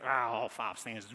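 low, reluctant grumble; mock congregation groan. Congregation reacting to a long hymn, mock groaning. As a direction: imitate a dismayed congregation grumbling. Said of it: "and everybody goes".

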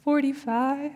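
small, tearful, softened reply; mock tearful answer. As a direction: answer meekly as if holding back tears. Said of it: "I'm blubbering".

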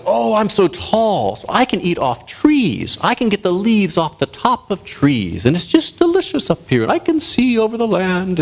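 high, slow storybook giraffe. Acted. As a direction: draw it out dreamily as a very tall giraffe. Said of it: "And the giraffe goes".

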